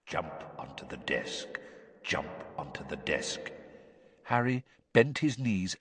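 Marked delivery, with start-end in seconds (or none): 0.1-3.5 low, hushed hypnotic command; a far-off voice inside the head; distant echoing voice. A narrator's reading. Cursing teacher commanding the boy's mind, low and distant. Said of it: "Moody's voice echoing in some distant chamber".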